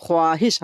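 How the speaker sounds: ख्वाहिश as kho-aa-hish, the wav sounded; spelling pronunciation. Shown as a demonstration. Teacher showing what is written, ख्वाहिश with its wav sounded. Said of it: "हम लोग लिखते हैं".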